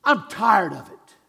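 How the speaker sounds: loud, earnest cry of weariness; showing the church how to say it with feeling; like you mean it. Shown as a demonstration. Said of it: "like you mean it".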